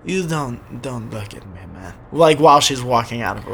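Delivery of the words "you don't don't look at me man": lightly voiced Russian accent. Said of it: "So I imagine it would be like".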